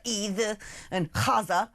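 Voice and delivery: mock pseudo-Arabic "either and Gaza", Gaza starting with kh; mockingly Arabicised "either" for Eid, "Gaza" as KHAH-za; pseudo-Arabic accent. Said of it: "weird pseudo-Arabic action, uh, accent".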